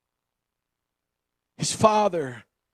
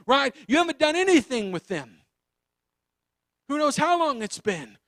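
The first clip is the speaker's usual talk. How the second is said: usual speaking voice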